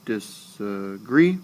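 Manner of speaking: disagree drawn out slowly, rising on GREE; slow dis-a-GREE with a lifted last syllable; anapestic stress. Shown as a demonstration. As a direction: stretch disagree up into a strong GREE. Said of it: "There's an anapestic foot".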